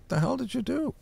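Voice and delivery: high mock demand, rising at the end; retold confrontation. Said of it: "I said".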